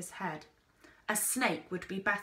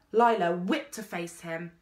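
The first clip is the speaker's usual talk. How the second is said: plain reading voice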